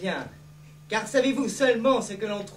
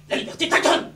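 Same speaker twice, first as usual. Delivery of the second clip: loud high laughing cry; laughing shout; 9 semitones higher, 11 dB louder, faster than usual. Acted. Mock proclamation with laughter. (crier en riant)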